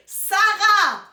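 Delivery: proud, ringing exclamation; triumphant reveal. Real, not acted. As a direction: announce the winning answer with a big flourish. Triumphant reveal of quiz answer.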